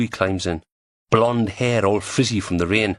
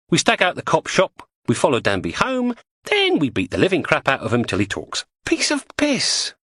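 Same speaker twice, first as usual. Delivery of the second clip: higher, brighter estuary woman; lightly voiced cockney woman; Julie's voice; 9 semitones higher than usual. Julie lays out the plan.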